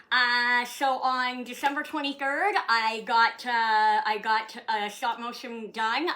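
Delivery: ordinary talk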